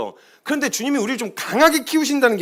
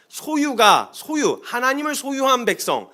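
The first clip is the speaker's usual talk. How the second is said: normal talking voice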